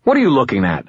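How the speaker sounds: mild snarl. A narrator's reading. Wizard to his watching dog, mild irritated snarl. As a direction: snap it with a touch of a snarl. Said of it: "I snarled".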